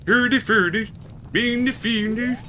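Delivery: high-pitched mock-Swedish gibberish; Swedish Chef. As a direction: do a singsong Muppet chef babble. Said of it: "The Swedish Chef from the Muppets".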